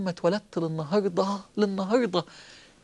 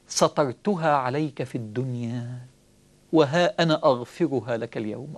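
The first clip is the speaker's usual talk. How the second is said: lower, softer, intimate call; voicing God, low and soft; 9 semitones lower, 3 dB quieter than usual. Preacher voicing God calling the servant near, low and soft. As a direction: draw the servant close gently.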